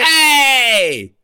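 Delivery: long, high Italian-style "hey"; hammed-up Italian shout; Italian accent. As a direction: holler it like a cartoon Italian. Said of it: "do an Italian accent".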